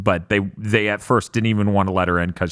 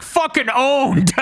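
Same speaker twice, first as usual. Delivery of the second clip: much higher, slow, hammed-up gloating; mock triumphant yell; 14 semitones higher, slower than usual. Mocking Deacon kicking rocks in the game, hammed-up high gloat. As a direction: crow it like a cartoon victor. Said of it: "being like".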